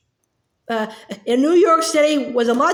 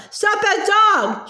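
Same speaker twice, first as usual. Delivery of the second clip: slow, higher call across the street; drawn-out higher shout; 7 semitones higher, much slower than usual. Acted. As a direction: call out to the crowd to stop the dog. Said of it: "she called".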